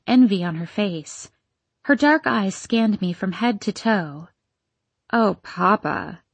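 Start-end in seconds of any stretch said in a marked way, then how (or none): 5.1-6.2 slow, drawn-out scorn; scornful. A narrator's reading. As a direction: roll the eyes at him. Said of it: "she said with scorn".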